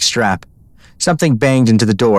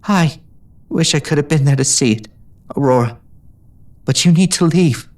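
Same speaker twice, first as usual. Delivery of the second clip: a touch of a trembling voice; shaking voice; 5 semitones higher than usual. A narrator's reading. Mentor sending pupil away, voice shaking. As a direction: hold back tears as you send her off. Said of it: "Ginger's voice shook".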